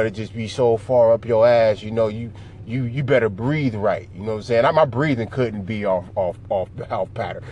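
ordinary delivery

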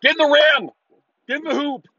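high, loud mocking fan cry; mock basketball fan yell. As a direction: imitate a dumb fan yelling at a shot. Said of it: "some people do that at a basketball shot".

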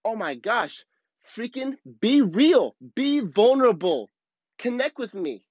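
high-pitched, drawn-out exasperated plea; fired up. Retold or imitated. Speaker silently urging someone to open up, fired up and exasperated. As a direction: imitate your own worked-up inner demand, stretching the words. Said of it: "I can get fired up".

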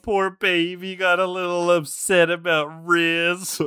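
mock baby talk. Teasing friend mockingly.

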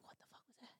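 hushed, breathless whisper; shocked whisper. Retold or imitated. Retelling the boyfriend's reaction to a ghost noise, a hushed whisper. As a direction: whisper it like you heard something in the dark. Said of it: "he was just like".

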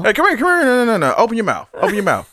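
high-pitched, hammed-up coaxing; mock urging. Doctor urging patient over to open her mouth, mock urging.

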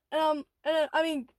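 usual speaking voice

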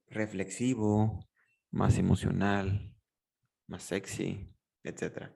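slow, low, reflective delivery; slow speaking. Shown as a demonstration. Coach showing when to speak slowly, slow and low. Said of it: "¿Cuándo hablar lento?".

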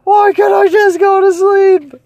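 high, loud frustrated yell; losing it at the noise, yelling; exasperated shout. Retold or imitated. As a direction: shout it like you've lost your mind. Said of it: "I'm like".

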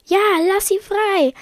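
high-pitched insistent demand; brother chiming in. Acted. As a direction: chime in eagerly, pitched high.

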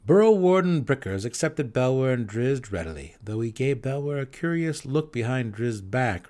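ordinary delivery, nothing marked

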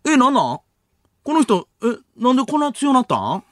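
high, puzzled comedian's questions; Hotohara-san asking the obvious. Acted. Comedian butting in on the panel, puzzled high-voiced impression. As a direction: pitch it high in naive bewilderment. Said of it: "当たり前のことを聞く蛍原さん".

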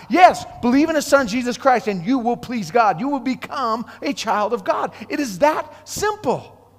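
ordinary talk, nothing marked

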